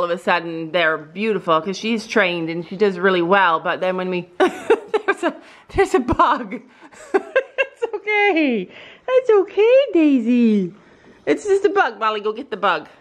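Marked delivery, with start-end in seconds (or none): 4.4-12.0 high, soothing dog voice; high pet talk. Real, not acted. Owner reassuring Daisy about the bug, high and soothing.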